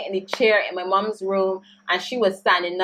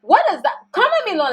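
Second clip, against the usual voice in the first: outraged disbelief; 9 semitones higher, 4 dB louder, faster than usual. Real, not acted. Outraged at the insinuation.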